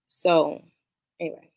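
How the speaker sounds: ordinary delivery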